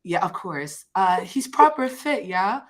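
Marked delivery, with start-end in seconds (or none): 1.2-2.6 badly faked British accent with a lifted pitch; atrocious British accent. Shown as a demonstration. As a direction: try out a bad British reality-show voice. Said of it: "My British accent is atrocious".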